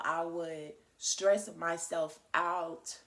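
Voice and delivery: "stress myself out" stretched slow and loud; heavy emphasis. Real, not acted. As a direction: lean hard on every word of "stress myself out". Stressing how badly she used to.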